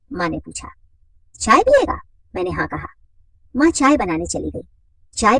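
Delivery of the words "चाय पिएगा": much higher and louder; mother's voice. Acted. Mother offering her son tea, higher and louder. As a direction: call it up high like a mother.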